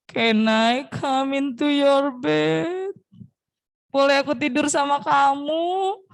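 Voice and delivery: slow, drawn-out plea, rising at the end; little mouse pleading. Acted. Little mouse asks to share the bed, drawn-out pleading. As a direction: stretch each word like a begging child. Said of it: "Tikus kecil bilang lagi nih".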